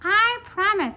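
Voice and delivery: high, loud squeaky creature; loud high-pitched cartoon voice; little pigtail voice. A narrator's reading. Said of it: "said the pigtail".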